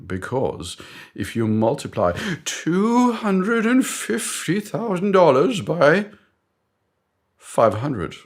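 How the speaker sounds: high, drawn-out mock-British lecture; British boss. British boss multiplying figures for colleague, high pompous mock-British. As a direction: explain grandly in a British accent, stretching the figures. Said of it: "I d-, don't know why he's British".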